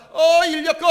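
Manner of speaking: loud, high hail for a rickshaw; calling out. Acted. Customer hailing a rickshaw at the gate, calling out. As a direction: shout out to flag down a passing puller. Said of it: "부르는 손님이 있었습니다".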